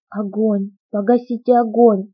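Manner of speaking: ordinary delivery, nothing marked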